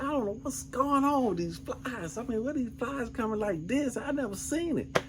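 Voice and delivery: high-pitched baffled wife voice; imitated wife. Husband mimicking his wife puzzled by the flies, high and baffled. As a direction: mimic her bewilderment way up high. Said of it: "So my wife, she said".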